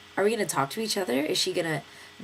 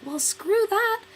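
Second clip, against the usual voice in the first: exasperated quote; 4 dB louder, much slower than usual. Retold or imitated. Friends giving up on bus.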